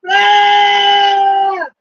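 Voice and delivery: loud high yelp; startled loud shout; sudden shout. Real, not acted. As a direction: let out a sharp loud cry. Shouts while others read answers.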